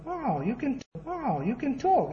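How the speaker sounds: high, drawn-out wonder, softly acted; amazed onlooker. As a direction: act out marvelling at a talking bird. Amazed passers-by addressing talking parrot.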